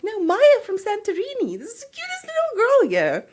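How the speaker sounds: very high, loud squealed correction; hammed-up excited squeal; gushing excitement. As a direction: act out adoring, insistent enthusiasm. Retells excitedly correcting her husband.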